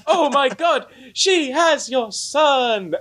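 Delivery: high, hammed-up gasp of shock, slowed; mock horrified discovery, laughing. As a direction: play the revelation big and breathless, half-laughing.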